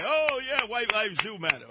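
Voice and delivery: high, gushing mock agreement; mock eager fan. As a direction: ham up a cheering fan, way up high. Mocking eager fans.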